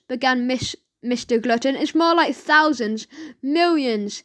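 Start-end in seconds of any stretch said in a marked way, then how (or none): none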